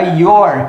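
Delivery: your said slowly as one short syllable; slow demo of the contraction your; contracted your. Shown as a demonstration. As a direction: glide you are into one quick your. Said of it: "vou contrair".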